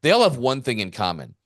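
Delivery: usual speaking voice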